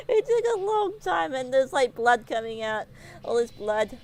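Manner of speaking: normal talking voice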